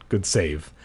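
usual speaking voice

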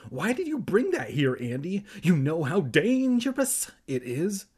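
high, insistent scolding question; acted alarm, leaning on "dangerous"; alarmed cry. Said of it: "Evan cried".

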